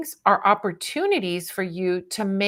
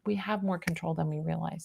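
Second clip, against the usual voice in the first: softer, lower echo of the statement; quiet repeat; 3 semitones lower, 9 dB quieter than usual. Real, not acted. Reader closing advice to a listener, quietly reflective. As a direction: drop the voice and let it settle.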